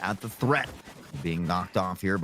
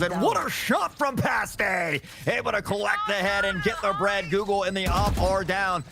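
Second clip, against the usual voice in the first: hype call; 9 semitones higher than usual. Real, not acted. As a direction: scream the big play. Caster hype on big play.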